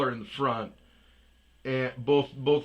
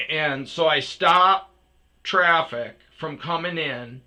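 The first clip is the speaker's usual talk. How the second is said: plain reading voice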